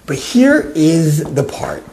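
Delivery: ordinary talk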